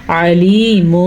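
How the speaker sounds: علیمٌ said slowly with the tanween turned into a میم sound; mim for tanween. Shown as a demonstration. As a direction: say علیمٌ as عليمم, closing on میم. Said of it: "ہم اس کو پڑھیں گے".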